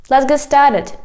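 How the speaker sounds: normal talking voice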